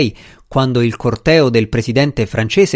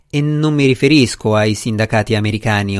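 plain reading voice

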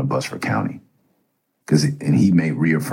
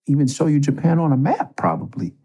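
higher, agitated self-scolding; mildly raised frustrated rant; frustrated inner voice; 10 semitones higher than usual. Retold or imitated. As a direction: berate yourself in a panic. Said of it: "I was like".

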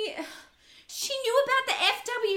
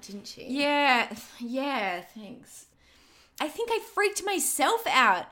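usual speaking voice

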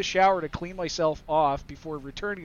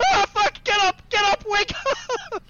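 high, loud panicked wake-up call; alarmed yell; 13 semitones higher, 4 dB louder than usual. Retold or imitated. Shouting to wake his wife after the accident, panicked. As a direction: yell at her to get up, fast. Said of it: "I said".

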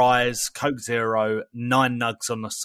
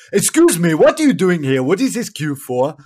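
put-on loud, raised African-accented voice; imitated African accent; 4 semitones higher, 8 dB louder than usual. Driver asking about the queue, imitated African accent. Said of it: "Apologies if I butchered the accent".

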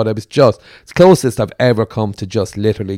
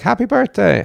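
bright, higher happy birthday call; cheery birthday greeting; 10 semitones higher than usual. Retold or imitated. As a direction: greet him like nothing's wrong. Brother rings on his birthday.

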